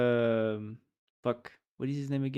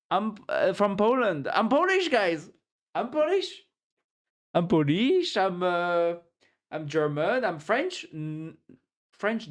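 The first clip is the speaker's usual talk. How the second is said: mocking American boast; 5 semitones higher than usual. Mocking Americans claiming Polish roots.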